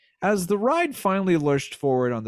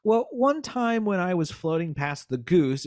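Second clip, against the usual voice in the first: normal talking voice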